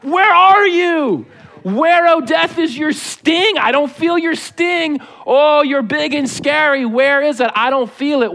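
high, drawn-out taunting shout easing off at the end; mocking triumphant taunt. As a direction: jeer at a beaten foe, standing over him. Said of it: "then he stands over death".

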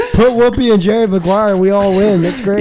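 loud, high, gleeful verdict; worked-up, triumphant outburst; excited. Real, not acted. Excitedly settling the game, running joke.

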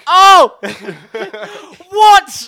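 high, loud, laughing shock; shocked amazement. Retold or imitated. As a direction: gasp in amazement.

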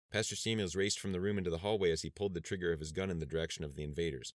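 plain reading voice

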